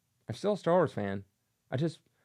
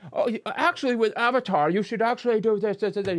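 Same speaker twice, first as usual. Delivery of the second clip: mock meddling producer; 8 semitones higher, 4 dB louder than usual. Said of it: "producer telling Cameron".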